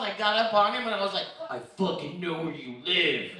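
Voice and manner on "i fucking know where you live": put-on low, slow menacing threat to a kid; mock threat. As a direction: lean in and growl it slowly. Threatening kid in ball crawl.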